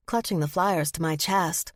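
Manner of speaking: ordinary delivery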